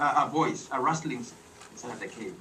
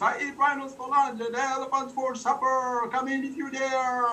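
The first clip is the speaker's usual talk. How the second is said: higher playful monster boast; lightly voiced monster threat; booming monster; 9 semitones higher than usual. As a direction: boom out the threat. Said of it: "the big booming voice came out".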